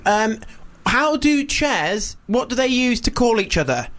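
imitated child's high-pitched riddle; a small girl carefully posing a riddle, high; four-year-old girl. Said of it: "she's about four or something".

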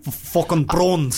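put-on slow South African accent. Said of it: "Oh, that's good".

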